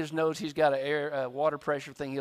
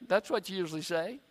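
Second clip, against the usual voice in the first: ordinary delivery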